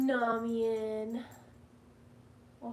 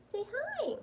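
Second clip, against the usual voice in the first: pet talk; 11 semitones higher than usual. Real, not acted. High coaxing to cat.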